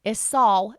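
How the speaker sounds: "saw" said with a full aw sound; "saw" with the W vowel kept. Shown as a demonstration. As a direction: say "saw" open and round, aw. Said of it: "like, S A W".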